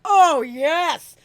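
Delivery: high, loud, gleeful oh yes; delighted cheer. Retold or imitated. Thrilled that her boyfriend now has a jingle bracelet, cheering. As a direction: cheer as if the problem is solved.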